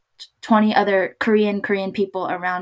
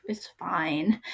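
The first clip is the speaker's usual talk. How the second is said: soft whispered reassurance; hushed it's-fine; 8 dB quieter than usual. Retold or imitated. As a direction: breathe it out gently so they stop apologising. Quoting herself reassuring.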